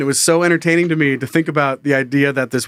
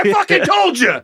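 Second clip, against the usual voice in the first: high, scolding comic shout; mock angry yell; 13 semitones higher than usual. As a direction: yell it like a vindicated waiter over the din. Waiter yelling amid imagined chaos.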